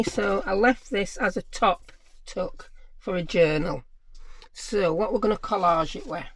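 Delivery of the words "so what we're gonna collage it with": normal talking voice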